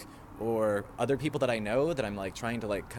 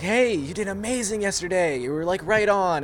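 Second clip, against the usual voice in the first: boss praising; 7 semitones higher, 5 dB louder than usual. Retold or imitated. Boss praising, acted quote.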